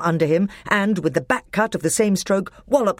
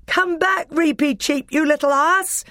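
high-pitched scolding call; shout; 11 semitones higher than usual. A narrator's reading. Peter calling Reapy Cheep out of the battle, high shout. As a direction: call back someone running into danger. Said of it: "shouted Peter".

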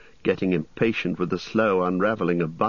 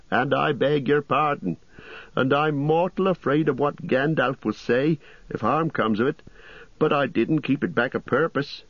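higher, bustling landlord voice; fussy innkeeper; 8 semitones higher than usual. Acted. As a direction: play the chatty innkeeper, pitched up.

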